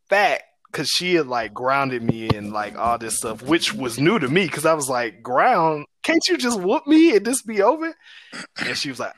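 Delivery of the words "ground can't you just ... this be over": put-on incredulous kid protest. Kid protesting being grounded.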